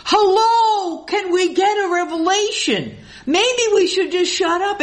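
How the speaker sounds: sarcastic mock voice, pitched well up; mocking sarcasm. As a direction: sneer at them with a sarcastic lilt. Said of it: "They're doing".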